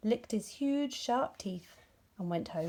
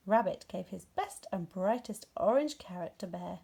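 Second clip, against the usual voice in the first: plain reading voice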